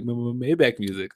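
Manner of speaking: mock teasing stutter. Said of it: "he'd be like".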